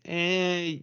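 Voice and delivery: high, drawn-out doubtful "eh"; skeptical "eh". Retold or imitated. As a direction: waver on it, unconvinced. Skeptical reaction to faked videos.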